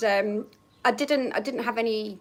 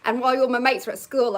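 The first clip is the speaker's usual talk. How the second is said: ordinary talk, nothing marked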